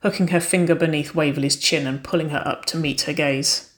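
ordinary delivery

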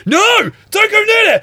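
high, slow shout; shouting at the screen. Retold or imitated. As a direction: yell a warning at the film. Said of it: "so I go".

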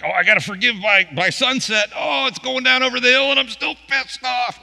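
high, loud, panicked whining voice; mock whiny churchgoer. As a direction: parody a fretful believer racing the sunset. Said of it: "some people are like".

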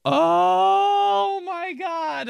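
long, high drawn-out groan; exasperated wail. Acted. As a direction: wail it out in dramatic dread. Dreads explaining beer names.

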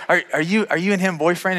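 awkward stuttered restarts on a question; stumbling. Retold or imitated. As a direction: trip over your words asking something personal. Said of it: "I'm just, like, stumbling".

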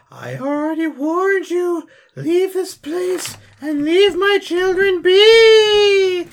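menacing otherworldly voice. Acted. Player in character protecting the children, high drawn-out warning. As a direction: warn them off in a long eerie wail.

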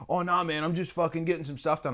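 a light impression of a shrugging mate; casual caller. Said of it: "they're like".

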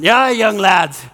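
put-on slow, emphatic Scottish accent. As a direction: stretch it out in an imitated Scottish brogue. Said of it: "this great Scottish accent".

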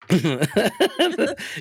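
high, loud burst of laughter. Real, not acted. Host still tickled by co-host's PSA, loud laugh.

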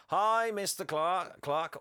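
drawn-out, high, loud chant; class in unison. Retold or imitated. Pupils greeting their teacher all together, class chant. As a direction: greet like a whole class, singsong and slow. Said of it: "all together".

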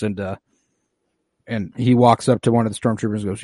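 normal talking voice

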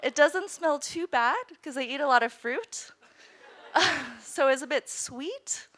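giggling while confessing the gross part; laughing. Real, not acted. Laughing at gross poop story.

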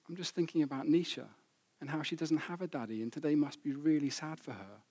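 mild, subdued sadness in retelling; softly voiced sad child. Olivia explaining her tears to her dad, softly sad. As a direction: quietly imitate an upset little girl, lightly.

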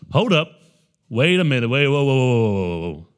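loud "hold on" protest, whoas tumbling downward; alarmed objection. Acted. Teachers of the law balking at Jesus, alarmed objection. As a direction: cut in loudly as if halting someone.

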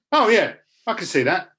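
mock East End viewer nodding along; East End accent. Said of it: "now he's got an East End accent".

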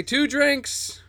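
high-pitched Southern drawl; Southern accent. Acted. Player's character orders drinks from the barmaid, high Southern accent. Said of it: "I could do a Southern accent".

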